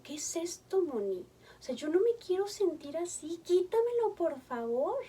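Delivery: un ruego agudo y desesperado; súplica angustiada. Retold or imitated. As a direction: pide que se lo quiten, suplicando. Adolescentes piden ayuda desesperados. (súplica angustiada, más aguda)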